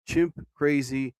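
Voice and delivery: ordinary talk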